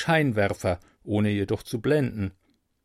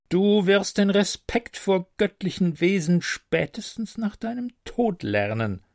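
higher, solemn angelic prophecy; prophesying; 8 semitones higher than usual. Acted. Angel prophesying to the mortal, lightly solemn. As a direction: pronounce his fate gravely. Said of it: "prophezeite Wendy".

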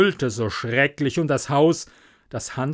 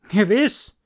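louder, much higher, rising; ready answer; 8 semitones higher, 5 dB louder than usual. Acted. Doctor agreeing to come, louder and higher. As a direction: agree at once. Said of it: "sagte der Doktor".

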